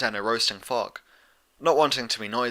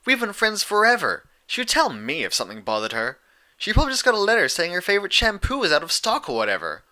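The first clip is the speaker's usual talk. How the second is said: scowling dismissal; 9 semitones higher, 4 dB louder than usual. A narrator's reading.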